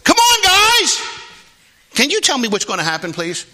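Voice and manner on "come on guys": normal talking voice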